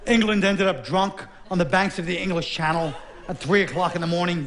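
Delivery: ordinary talk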